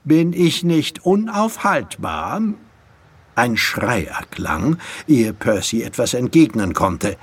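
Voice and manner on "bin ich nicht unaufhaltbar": high, triumphant boast; self-satisfied crowing. Acted. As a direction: gloat as the unbeatable winner. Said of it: "krähte Drake".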